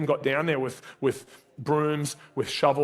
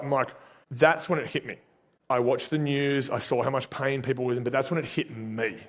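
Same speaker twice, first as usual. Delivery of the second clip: ordinary talk, nothing marked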